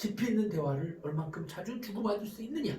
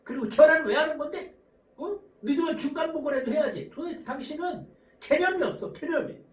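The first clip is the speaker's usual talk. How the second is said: raised, higher-pitched scolding; acted nagging spouse; 6 semitones higher, 3 dB louder than usual. Spouse scolding partner for always coming late, acted angry. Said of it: "꼭 이렇게 말합니다".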